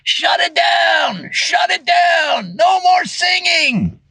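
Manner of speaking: held-back, high-pitched pretend shout; imitated neighbors yelling. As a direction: call out slowly like an irate neighbor at a window. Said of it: "all the neighbors were yelling out their windows".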